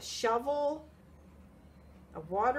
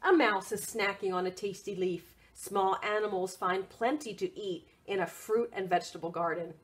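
lively picture-book read; reading to children; 6 semitones lower than usual. Acted. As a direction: read it out brightly for little ones.

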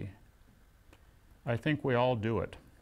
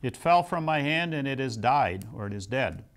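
louder, tense retort imitating the housekeeper; a little starch; 2 semitones higher, 5 dB louder than usual. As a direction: push back with some tension. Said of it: "a little starch, you know, a little tension".

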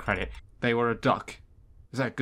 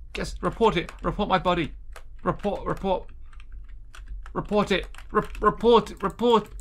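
high, insistent repeated urging; urgent pleading; 8 semitones higher than usual. Real, not acted. Dead player urges others to report the body, high and insistent. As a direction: press them to act, voice pitched high.

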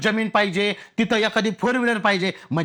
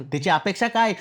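usual speaking voice

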